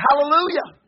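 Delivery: high, loud hallelujah; exuberant praise. Retold or imitated. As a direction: call out praise over the music. Own praise during music, retold.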